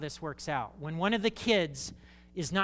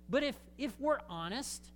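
usual speaking voice

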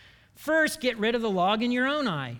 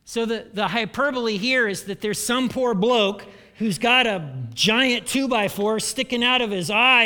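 ordinary talk

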